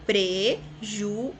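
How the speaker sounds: "Prejuízo" very slow, PRE... JU... Í... ZO; four separate voice pulses in "Prejuízo"; syllable by syllable. Shown as a demonstration. Teacher shows the impulse of voice, syllable by syllable. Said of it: "o impulso de voz?".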